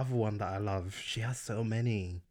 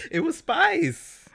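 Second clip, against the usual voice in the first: giggling through the words; laughing; 8 dB louder, much slower than usual. Real, not acted. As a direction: say it while laughing. Laughing while naming Spice.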